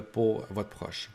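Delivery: normal talking voice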